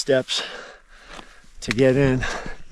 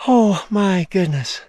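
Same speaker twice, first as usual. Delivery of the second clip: awed; 6 semitones higher, slower than usual. Real, not acted. Awed by the summit view.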